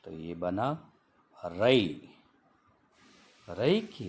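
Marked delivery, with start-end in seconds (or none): 1.5-2.1 रई said louder and clear; teacher sounds out रई; model word रई. Shown as a demonstration. Teacher reading रई for the class, clear model. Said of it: "आर को पढ़ा जाता है र".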